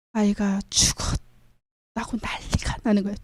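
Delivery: put-on slow, soft whisper about the dead child. Preacher to congregation, telling of the mourning, whispered slowly. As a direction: hush it, slowly, almost unvoiced.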